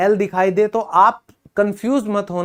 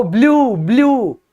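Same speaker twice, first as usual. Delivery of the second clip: Blue blue called out a bit higher, pretending excitement; mock excited tourist; 3 semitones higher, 4 dB louder, slower than usual. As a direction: play the thrilled traveller spotting blue. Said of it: "आप एकदम से excited हो गए".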